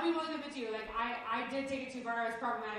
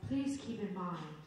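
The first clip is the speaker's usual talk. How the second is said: soothing British voice; 4 semitones lower than usual. Retold or imitated. Audiobook narrator reading her a disclaimer, light soothing British voice. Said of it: "this very soothing British voice".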